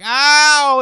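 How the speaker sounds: very high, loud mock "Ow!" yelp; kid's yelp. Dad imitating his kids crying out, high mock yelp. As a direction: squeal it like a hurt kid. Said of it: "They're like".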